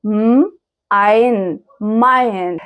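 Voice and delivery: "م", "عين", then blended "ماين"; sounded-out word. Shown as a demonstration. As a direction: break "ماين" into m and ine, then join. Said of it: "زي كلمة ماين".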